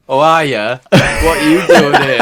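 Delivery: lightly done northern voice; a hint of a northern mate, laughing; imitating a northern friend. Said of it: "he comes in and he goes".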